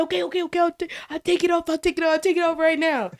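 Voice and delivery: put-on high, hurried kid voice; kid giving in. Boy promising his teacher, high and rushed. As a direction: cave in fast like a scolded boy. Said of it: "He said".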